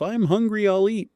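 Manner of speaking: squeaky mock voice for an obvious statement; mock silly voice. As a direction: play the absurd line like a cartoon. Mock example of absurd phrasing.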